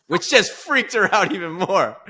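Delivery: high, loud, drawn out with laughter; laughing. Real, not acted. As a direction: deliver it slowly while cracking up. Laughs at wife's growing alarm.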